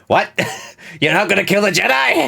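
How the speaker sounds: high, loud mock disbelief through laughter; laughing shout. Real, not acted. Joking about refusing the Emperor, laughing shout. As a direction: shout it while cracking up.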